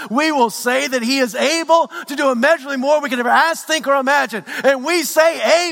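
high, loud building sermon delivery; rising preacher's cadence, pushing up in pitch; preaching at full force. Acted. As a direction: preach it out to the whole church.

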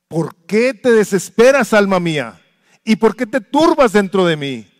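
loud, high, impassioned pleading prayer; preaching at full force. Acted. Preacher crying out to God for the congregation, impassioned. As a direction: cry it out from the pulpit.

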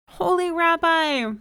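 high, loud, drawn-out cry; shriek. A narrator's reading. Man at his limit calling the rabbi, shrieked. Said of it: "he shrieked".

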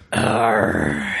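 long low "arr" like a pirate; frustrated growl. Shown as a demonstration. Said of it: "I go".

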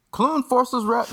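slow, high announcer voice; newsreel voice. Shown as a demonstration. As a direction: read it like an old newsreel announcer. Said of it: "bonus points if you do it in the newsreel voice".

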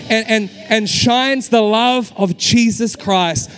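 loud, driving sermon climax; full-force preaching. Acted. Pastor rallying his church to be countercultural, full-force preaching. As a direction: preach it out with rising fervour.

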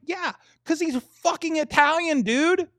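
high, loud, emphatic protest; exasperated insistence. Real, not acted. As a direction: insist as if it should be obvious. Arguing that the man is Italian.

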